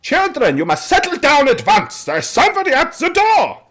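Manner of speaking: high, loud, stern matron calling over the noise; a stern woman ordering children to settle; stern matron raising her voice over shouting kids. Acted. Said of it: "fairly kind of stern voice saying".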